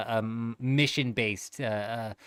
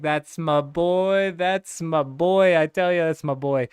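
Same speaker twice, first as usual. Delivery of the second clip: proud dad; 4 semitones higher, 6 dB louder than usual. Retold or imitated. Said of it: "I was like".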